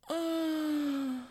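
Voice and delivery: long, very high sustained moan; mock final scream. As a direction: imitate a drawn-out scream without full volume. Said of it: "she's just screaming".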